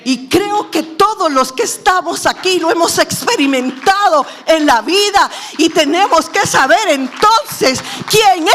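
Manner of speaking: proclamación gritada, muy aguda y fuerte; prédica a plena fuerza. Real, not acted. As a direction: exhorta a la congregación a voz en cuello. Predica a gritos. (predicando a gritos)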